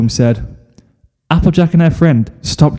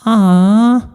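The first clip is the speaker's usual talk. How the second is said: high drawn-out aww; swooning aww; 10 semitones higher than usual. Acted. The girls watching the kiss, a high swooning aww. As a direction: coo over the kiss. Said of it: "the girls all went".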